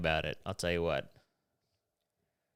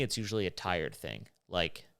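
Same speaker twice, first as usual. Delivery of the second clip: normal talking voice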